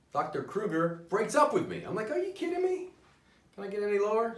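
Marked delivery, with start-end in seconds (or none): 2.1-4.4 high-pitched indignant protest; outraged disbelief. Acted. Patient just dumped by his therapist, outraged disbelief. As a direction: whine upward in mock outrage.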